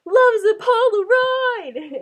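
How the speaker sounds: loud, high, slow belted line; dramatic proclamation. Retold or imitated. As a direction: belt it out like a grand stage line. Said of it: "she's like".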